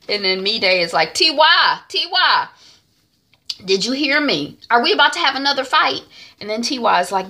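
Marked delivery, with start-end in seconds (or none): 1.1-6.0 higher, louder scolding; impatient calling. Retold or imitated. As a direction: demand someone's attention, mildly exasperated. Me Day calls distracted T Y sharply.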